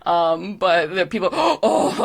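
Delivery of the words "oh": high, astonished gasp and oh; imitated audience gasp, pitched up; gasping crowd. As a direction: gasp like spectators staring upward. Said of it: "gasping as they're, like, watching".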